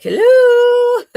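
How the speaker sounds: loud, high call into emptiness. Pretend. To absent guides, a loud high call into nothing. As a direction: shout it out like nobody answers. Calling out into empty silence.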